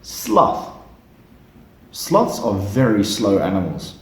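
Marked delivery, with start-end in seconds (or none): 0.0-0.7 model word. Shown as a demonstration. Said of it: "Let's try one more time".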